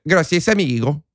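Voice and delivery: badly faked Mexican Spanish accent; exaggerated Mexican accent. Shown as a demonstration. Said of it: "I don't know how to do voices".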